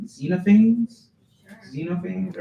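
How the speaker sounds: tentative name with questioning lift; unsure pronunciation. Real, not acted. Poet checks philosopher's name with audience, unsure and questioning. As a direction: try the name out, asking if it is right. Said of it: "I'm not sure how to say this".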